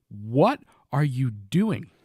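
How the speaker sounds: slow, drawn-out disbelief; shocked. Retold or imitated. Owner seeing his dog swim in the pond, slow stunned disbelief. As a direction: stretch it out, stunned. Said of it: "I was like".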